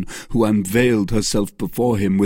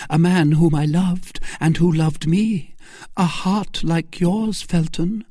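high, louder tender confession; Milady confiding; 10 semitones higher, 3 dB louder than usual. Acted. Milady telling Felton her tale. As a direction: win him over gently.

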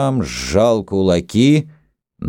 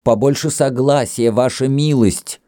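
raised, pleading appeal for calm; admonishing; 8 semitones higher than usual. A narrator's reading. Host urging two quarrelling guests to agree, high admonishing appeal. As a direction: reason with them, voice lifted high. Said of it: "усовещивал обоих хозяин".

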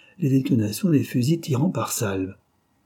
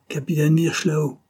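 gruff, higher barked name; rough; 6 semitones higher than usual. A narrator's reading. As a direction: address the captain curtly. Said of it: "fit-il rudement".

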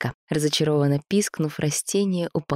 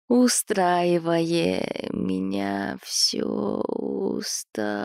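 nodding off; much slower than usual. Acted. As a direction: mumble it as sleep takes over. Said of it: "пробормотал Павла, засыпая окончательно".

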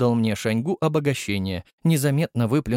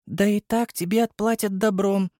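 gently voiced young girl; Nastya's voice; 9 semitones higher than usual. A narrator's reading. Nastya speaks. (высокий девичий голос)

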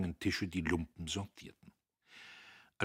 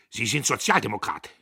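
loud, hard question; outraged challenge; accusing; 3 semitones higher, 11 dB louder than usual. A narrator's reading. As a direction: fling it at him as an accusation. Boss accuses foreman of politics.

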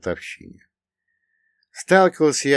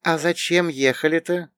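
put-on higher-pitched girl's voice; curious girl putting a question, pitched up; young woman character; 8 semitones higher than usual. A narrator's reading. (девичий голос повыше, с вопросом)

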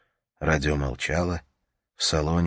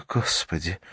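ordinary delivery

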